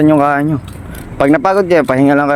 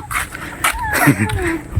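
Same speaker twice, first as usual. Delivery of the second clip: high squealing laugh; 8 dB quieter than usual. Real, not acted. Laughs at friend's shadow boxing.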